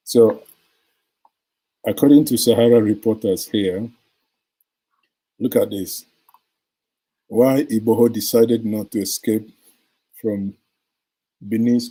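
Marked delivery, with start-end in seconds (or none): none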